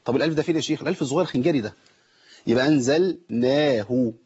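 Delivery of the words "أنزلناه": slow "أنزلناه" with a measured long aa; two-count madd. Shown as a demonstration. Teacher demonstrating natural madd, "أنزلناه" held two counts. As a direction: hold the naa of "أنزلناه" for two beats. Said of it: "آخد حركتين".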